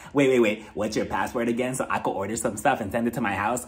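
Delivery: low-pitched, sly impression; Erica. Imitating her asking a friend for a password, mock sly. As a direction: drop the voice for a joking scheme. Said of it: "She told Kyle".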